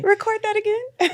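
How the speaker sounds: high, loud hammed-up producer; mock producer. Mocking show producers ordering a retake, loud and high. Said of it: "they probably was like".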